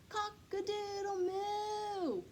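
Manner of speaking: high, slow drawn-out moo-call; cow mooing a crow. Acted. Cow bellowing its attempt at cock-a-doodle-doo. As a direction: stretch it out like a big cow trying to crow.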